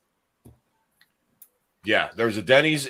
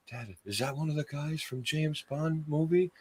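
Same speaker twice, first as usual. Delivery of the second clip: put-on small, soft, higher kid voice; child asking dad; 4 semitones higher, 12 dB quieter than usual. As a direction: ask Dad quietly, like a little kid. Acts himself as child asking dad.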